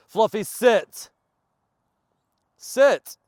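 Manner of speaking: high, loud, drawn-out command; commanding shout. Real, not acted. Trainer shouting at running dog to sit, loud. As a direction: stop the dog in its tracks. Stopping dog mid-run.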